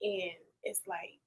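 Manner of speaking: soft whisper. Real, not acted. Emotional moment about giving back.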